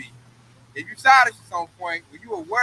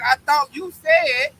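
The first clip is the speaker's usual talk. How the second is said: drawn-out, higher, hurt quoting; slow whiny complaint; 6 semitones higher, 4 dB louder, much slower than usual. Retold or imitated. A betrayed follower complains about the broken promise, slow and whiny. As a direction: stretch it out like a betrayed man. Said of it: "'Cause he like".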